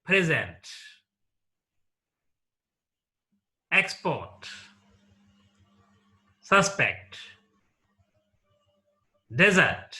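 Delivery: Present, Export, Suspect, Desert, each punched on its first syllable; first-syllable stress. Shown as a demonstration. Teacher listing noun examples, first syllable stressed. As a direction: say nouns PRE-sent, EX-port, SUS-pect, DE-sert. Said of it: "they take stress on the first syllable".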